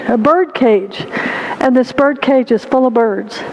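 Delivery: plain reading voice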